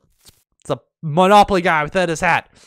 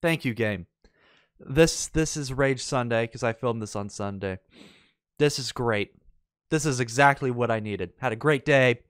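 much softer, lower sarcastic mutter; deadpan sarcasm; 6 semitones lower, 9 dB quieter than usual. Real, not acted. Player after getting kicked, sarcastic quiet thanks. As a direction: thank the game with bitter irony.

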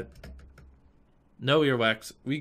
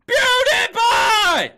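very high, slow, held screaming PewDiePie; long shout; 18 dB louder, much slower than usual. Acted. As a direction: belt it out long. Held chant cry.

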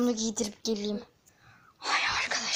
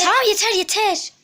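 loud scolding; 8 semitones higher, 11 dB louder than usual. Real, not acted. Hushing loud laugh near sleeper.

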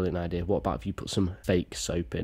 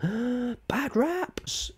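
mock gasp. Mock gasp at prank title.

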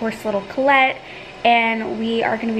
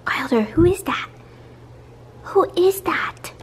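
whispering; 6 semitones higher than usual. Real, not acted. Asking baby in hush.

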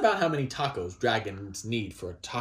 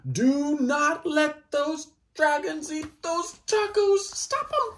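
much higher, louder, slow desperate call; frantic storybook alarm; panicked plea; 5 dB louder, slower than usual. Pretend. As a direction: beg someone to stop them.

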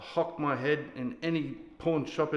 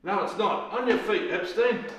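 raised, high-pitched military command; barked order; 7 semitones higher, 4 dB louder than usual. Acted. Sergeant orders Epstein onto his feet, barking. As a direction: bark it as an order to a subordinate.

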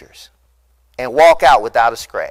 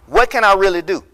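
ordinary talk

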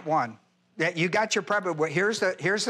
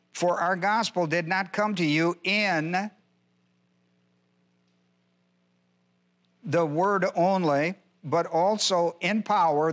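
measured, deliberate verse reading; unhurried Bible reading; slow scripture reading. Acted. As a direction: read it slowly for the class. Reads the verse again.

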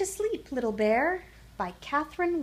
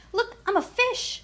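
high, louder little bear cub shout; excited shout; 13 semitones higher, 4 dB louder than usual. A narrator's reading. Little Bear shows off to his mother, excited high shout. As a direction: call out in high, childlike excitement. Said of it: "shouted Little Bear".

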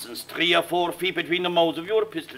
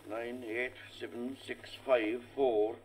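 much softer, low counting of paces; counting under breath; 6 semitones lower, 13 dB quieter than usual. Acted. Sir Lucius pacing out the dueling distance, counting softly. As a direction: count the steps to yourself.

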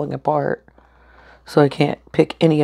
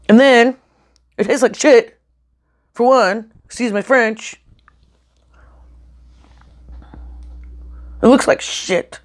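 angry; 5 semitones higher than usual. Real, not acted. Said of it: "it makes me so mad".

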